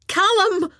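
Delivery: high, loud frightened cry; terrified scream. Acted. Maggie screaming for Callum in terror, high loud cry. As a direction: scream his name in terror. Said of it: "Maggie screamed, sounding terrified".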